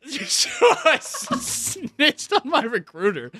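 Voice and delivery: very high, slow words through laughter; laughing. Real, not acted. Admitting to the co-host he snitched, laughing. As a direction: confess it while cracking up.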